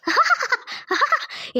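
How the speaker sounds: a high eerie ghostly laugh. Acted. Conscience appearing to Lakshmi, high eerie laugh. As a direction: laugh like a spirit arriving.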